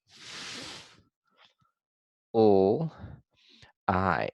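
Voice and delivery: "F", "l", "I" as isolated sounds with long gaps; sounding out. Shown as a demonstration. Teacher writes each sound of fly, sounding out slowly. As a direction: stretch out "F", "l", "I" separately while writing. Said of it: "So I'll write those sounds".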